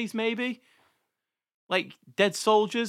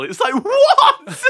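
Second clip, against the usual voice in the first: high, slow, incredulous laugh-talk; laughing disbelief; 8 dB louder, slower than usual. Real, not acted. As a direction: laugh out a baffled what. Laughing disbelief at list item.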